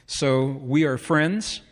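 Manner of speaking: normal talking voice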